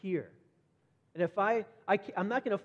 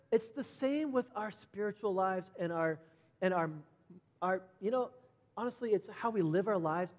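ordinary delivery

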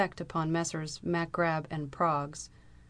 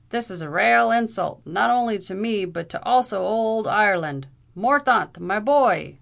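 loud, high Irish protest; furious Irish brogue; 4 semitones higher, 9 dB louder than usual. A narrator's reading. Irish captain protests the insult to his friend. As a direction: rage in a brogue for old Ireland.

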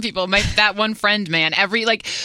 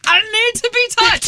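pleading, much higher and louder; high desperate plea; 12 semitones higher, 3 dB louder than usual. Retold or imitated. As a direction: beg to be touched, loudly. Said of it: "I'm like".

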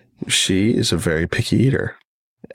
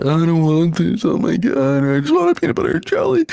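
put-on high, whining little-girl voice, far above his own; whiny toddler. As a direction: whine like a three-year-old refusing dinner. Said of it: "She either cried".

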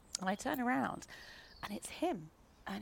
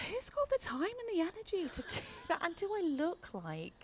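higher, exasperated disbelief with a laugh; incredulous scoff; 10 semitones higher than usual. Retold or imitated. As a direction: scoff at the absurd idea. Mocking the pregnancy-faking suspicion.

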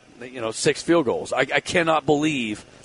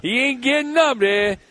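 mock Pittsburgh accent, pitched up; 9 semitones higher than usual. Radio host teasing about a player staying down, in a mock Pittsburgh accent. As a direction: put on a Pittsburgh accent, higher. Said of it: "in that Pittsburgh accent".

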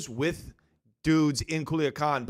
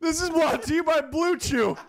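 high, bright sign-off voice, louder; mock YouTuber; 11 semitones higher, 3 dB louder than usual. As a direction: ham up a hostage YouTuber outro. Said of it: "I look at the camera and I'm like".

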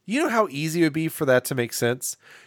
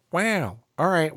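Owen Wilson; 3 semitones higher than usual. Acted. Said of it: "and he's like".

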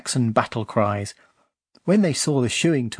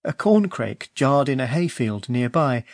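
usual speaking voice

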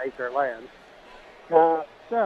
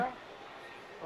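barely audible; 7 semitones higher, 13 dB quieter than usual. Real, not acted. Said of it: "I can barely hear you".

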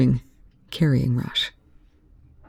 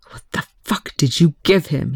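roared. Acted. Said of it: "Young roared".

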